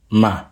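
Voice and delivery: Ma kept on a low level pitch. Shown as a demonstration. Teacher models a low tone for learners: Ma low. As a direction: drop Ma low, no whisper. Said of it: "Listen to the low tone".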